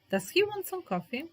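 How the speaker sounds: "he" lifted way up in "Does he want some coffee?"; high question. Shown as a demonstration. Teacher turns a statement into a high-pitched question. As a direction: pitch "he" high, then fall.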